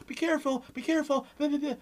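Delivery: high-pitched, nagging worry; mocking helicopter-parent warnings; mock fussing parent. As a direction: fret like a hovering parent. Mocking a hovering, overcautious parent.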